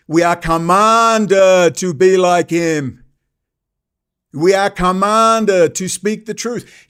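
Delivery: commanded stretched out slow and loud; slow emphatic preaching. Real, not acted. Preaching about Jesus at the temple.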